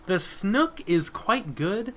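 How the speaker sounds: slow, disbelieving repeat; incredulous echo. Pretend. Player repeats the fishmonger's line slowly in disbelief. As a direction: drag it out in disbelief.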